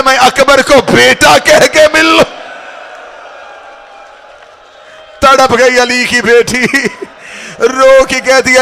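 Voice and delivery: high, loud, slow wailing plea; crying lament. Acted. Preacher voicing Laila begging Abbas, high loud wailing. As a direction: cry out a mother's longing.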